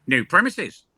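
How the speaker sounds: high, loud, drawn-out parrot. Acted. Brothel parrot seeing its new home, parrot voice. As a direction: slowly squawk it like a talking parrot. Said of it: "the parrot looks around and goes".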